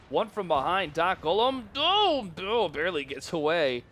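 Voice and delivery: rising commentator excitement peaking on a long "oh"; excited play-by-play. Real, not acted. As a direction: voice leaping high and loud. Caster calls a narrow escape.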